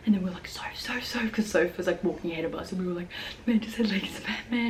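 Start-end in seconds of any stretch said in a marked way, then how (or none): 0.5-1.3 whispered call to a friend ahead; re-enacted secretive whisper; urgent whisper. 3.4-4.7 secretive, urgent whisper; re-enacted hushed alarm; whispered warning.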